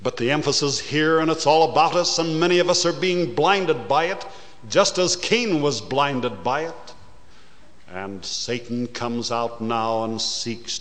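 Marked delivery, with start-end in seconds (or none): 0.0-6.9 higher and fast, urgent; preaching at full force. Acted. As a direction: press it on the listeners urgently. Preacher presses the lesson.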